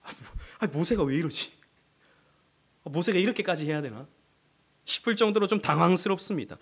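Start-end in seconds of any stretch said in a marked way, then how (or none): none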